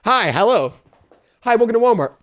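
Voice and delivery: chirpy mock Walmart greeter; mock cashier greeting. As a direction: parody a store greeter. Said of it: "always the same".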